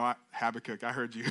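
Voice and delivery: usual speaking voice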